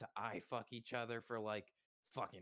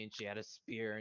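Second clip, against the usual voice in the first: normal talking voice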